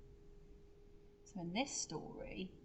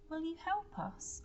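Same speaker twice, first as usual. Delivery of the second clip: high pleading question from the wolf; gentle higher creature plea; 9 semitones higher than usual. Acted. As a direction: ask hopefully in a small high voice. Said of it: "asked the wolf".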